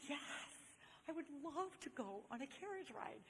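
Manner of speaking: soft, high, wishful voice; delighted inner thought. Retold or imitated. Girl thinking about a carriage ride, soft and eager.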